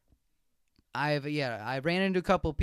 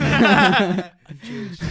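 slow, loud, drawn-out mock proclamation; loud declaration; 10 dB louder, much slower than usual. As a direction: stretch it out and belt it in character. Loud character repeat for laughs.

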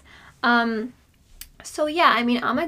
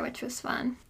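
soft unvoiced whisper; 11 dB quieter, slower than usual. Real, not acted. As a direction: whisper so the mic barely hears. Recording in a hot closet.

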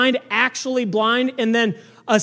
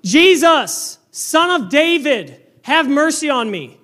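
desperate crying out; 4 semitones higher, slower than usual. Acted. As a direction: shout out, begging to be heard. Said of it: "and he's screaming".

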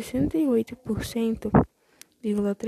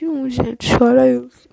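loud excited outburst; excited exclaim; 2 semitones higher, 15 dB louder than usual. Real, not acted. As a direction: call out in excitement. Exclaims at the late date.

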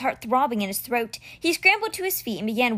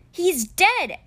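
high, raised cry dropping at the end; fearful shout; 8 semitones higher, 4 dB louder than usual. A narrator's reading. Boy breaks the death to the cheetah, fearful shout. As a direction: blurt it out loudly from fear. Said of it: "Alex shouted, more from fear".

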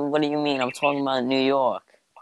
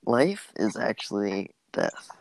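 hippie voice. Pretend. Podcaster conjuring up a silly theory, hippie voice. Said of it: "like, the most hippie thing".

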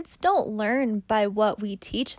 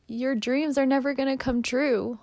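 put-on higher, pained line to one's own child; gently voiced, hurting to say it; speaking to daughter; 4 semitones higher than usual. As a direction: say it reluctantly, as if breaking it to her. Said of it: "Read these off as if you are speaking to Lena".

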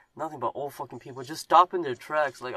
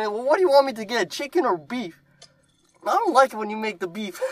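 higher, louder hammed-up phone yell; imitated yelling; 9 semitones higher, 7 dB louder than usual. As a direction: shout the food order at mom.

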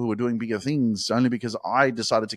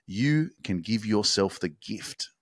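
soft sincere encouragement; 3 semitones lower, 5 dB quieter than usual. Real, not acted. Soft slow closing affirmations.

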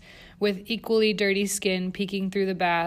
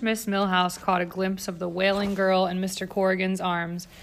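usual speaking voice

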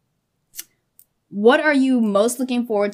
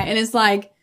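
normal talking voice

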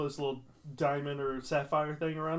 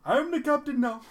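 high, loud movie-villain quote; movie captain; 11 semitones higher, 5 dB louder than usual. Retold or imitated. Friend joining in a movie quote, high loud captain. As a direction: declare command in a put-on high voice.

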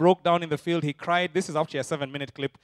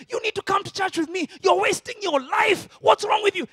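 much higher, louder, near shouting; eager badgering; 14 semitones higher, 6 dB louder than usual. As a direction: push your friend to come, urgently. New believer badgers his friends.

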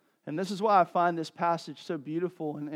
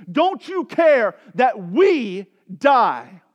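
slow, louder cry of disbelief; anguished plea; 7 semitones higher, 12 dB louder, much slower than usual. Retold or imitated. Disciples crying to a sleeping Jesus, slow anguished plea. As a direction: drag it out, wounded. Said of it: "they say to Jesus".

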